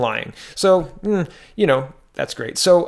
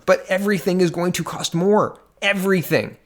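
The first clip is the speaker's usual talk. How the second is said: usual speaking voice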